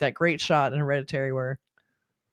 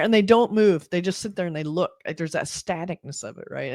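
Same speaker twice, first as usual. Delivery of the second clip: usual speaking voice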